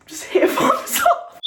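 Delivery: high shout breaking into laughter; laughing outburst. Real, not acted. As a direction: crack up while asking. Bursts out laughing mid-request.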